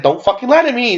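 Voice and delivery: high-pitched mock accusation; retold accusation. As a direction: pitch it up, imitating an angry demand. Retold threat in a confrontation.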